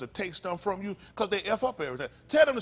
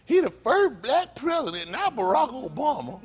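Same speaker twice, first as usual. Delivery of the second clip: higher, louder, slow, hammed-up proud voice; mocking fans; 5 semitones higher, 3 dB louder than usual. Imitating people who praised Clinton, slow hammed-up pride. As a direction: parody folks bragging about Clinton.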